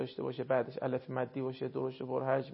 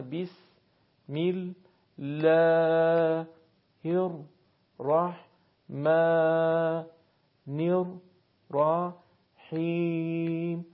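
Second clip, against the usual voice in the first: long vowels held for two beats; syllable-by-syllable recitation; much slower than usual. Shown as a demonstration. Teacher shows students two-beat long vowels, slow syllabic recitation. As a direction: chant it slowly, each syllable apart. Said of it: "صدای کشیده رو دو ضرب".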